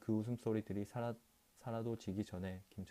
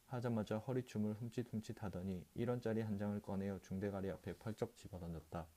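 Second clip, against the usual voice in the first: plain reading voice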